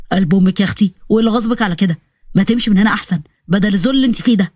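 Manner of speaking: low-voiced jittery soldier, puzzled and scared; low voice of a frightened recruit; nervous soldier. Salah voice again.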